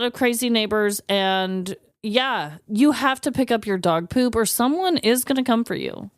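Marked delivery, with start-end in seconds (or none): none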